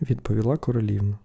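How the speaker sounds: usual speaking voice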